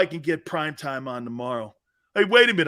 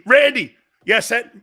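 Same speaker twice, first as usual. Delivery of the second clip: normal talking voice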